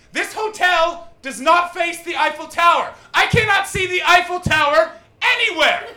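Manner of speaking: high, loud indignant yelling; mom's outraged shout. Retold or imitated. Mom yelling at the cab driver about the view, outraged shout.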